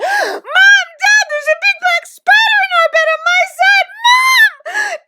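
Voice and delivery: put-on very high, very loud child's scream; panicked screaming. A narrator's reading. Anne waking her parents about a spider, screaming. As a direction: scream for help at full pitch. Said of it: "went screaming into her mother and father's room".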